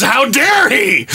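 high, slow indignant mock outrage; hammed-up high-pitched indignation; Mike Francesa impression. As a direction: huff it out like an outraged radio host. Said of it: "my Mike Francesa is".

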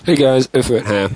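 ordinary talk, nothing marked

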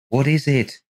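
rising alarm. A narrator's reading. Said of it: "she muttered apprehensively".

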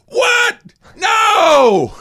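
very high, loud shriek of protest; imitated outraged yell. Mother protesting in a nearly empty gym, imitated yell. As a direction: mimic a drawn-out yell at the ref. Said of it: "Susan yells at referees in volleyball".

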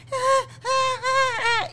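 high-pitched mock child crying; short high wails like a tired little girl; imitated toddler wailing. Mother imitating her daughter starting to cry, whiny wail. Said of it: "mi hija empezó".

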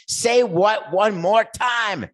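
very high, drawn-out demand; hammed-up movie quote. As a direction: play the famous threat to the hilt, slowly. Repeats the movie line, bigger.

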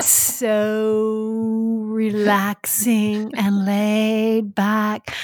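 drawn out, very slow and laid back; slow and relaxed. Retold or imitated. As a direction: stretch every word lazily. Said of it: "I talk so slow".

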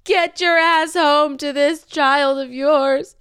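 high, loud, slow demanding shout; hammed-up furious order, every word stretched; exasperated yell. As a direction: holler it at an absent partner. Retold angry demand to partner.

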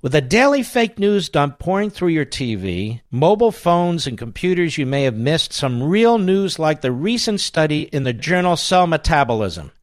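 upbeat host-read ad. Acted. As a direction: sell it like a radio ad. Host reads sponsor ad.

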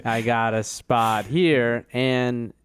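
slow, drawn-out Midwest talk; slow Midwest patient. Retold or imitated. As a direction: drag each word out slowly. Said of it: "And they're like".

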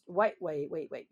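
usual speaking voice

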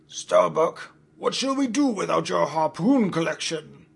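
captain calling after the falling Starbuck. Acted. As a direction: call down after him over the side. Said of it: "the captain called off to him".

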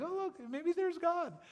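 high, slow and airy; mocking someone who sees God in a puffy cloud; mock naive wonder. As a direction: point up at a passing cloud in mock awe. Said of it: "where they'd be like".

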